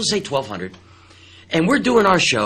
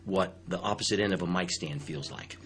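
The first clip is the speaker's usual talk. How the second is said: low, much softer menacing warning; quiet threat; 6 semitones lower, 9 dB quieter than usual. Retold or imitated. [name] to the host, a quiet menacing threat.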